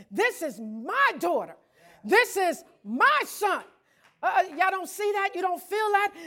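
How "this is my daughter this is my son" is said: proud boast, building higher and louder. Acted. The Lord boasting to the world, proudly building louder. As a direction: show off your children. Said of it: "can you see him bragging and boasting?".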